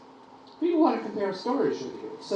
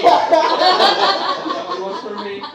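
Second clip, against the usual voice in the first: higher, louder, slow laughing repeat; 8 semitones higher, 8 dB louder, much slower than usual. Shown as a demonstration. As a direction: stretch it out through laughter.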